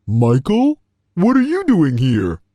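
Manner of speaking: mildly astonished question, lifted in pitch; surprised. Acted. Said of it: "looked very surprised to see me".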